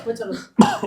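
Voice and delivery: high mocking rise on होता; sarcastic echo. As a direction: sneer back his excuse.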